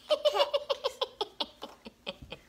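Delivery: high-pitched, rattling giggle; helpless high giggle; high laugh. Real, not acted. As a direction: let out a long high cackle that falls away. Laughs at the endless chores.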